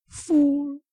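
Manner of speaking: loud high squawk; high scolding bird cry. Acted. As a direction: screech the word at him.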